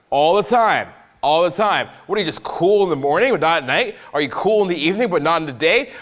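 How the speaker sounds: usual speaking voice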